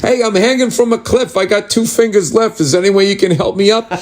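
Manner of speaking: high put-on caller voice; cliff-hanger's plea. Someone hanging from a cliff asks for help, mock plea.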